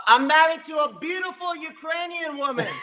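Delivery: high giggly laughter through the words; laughing. Real, not acted. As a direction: laugh bashfully while bragging. Bashful laugh about his wife.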